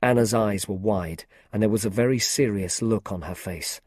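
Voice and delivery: ordinary delivery, nothing marked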